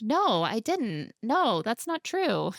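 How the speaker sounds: high, drawn-out mock protest; exaggerated, slow protesting quote; protesting denial.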